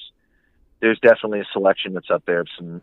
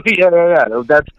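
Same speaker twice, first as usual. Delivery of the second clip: high, loud words through real laughter; laughing agreement; 8 semitones higher, 8 dB louder than usual. Real, not acted. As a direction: crack up while agreeing. Laughing at the worm joke.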